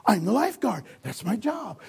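ordinary talk, nothing marked

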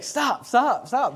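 mock-yelled, high squabbling; bickering brothers. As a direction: snap back like an arguing kid. Acted back-and-forth argument of brothers.

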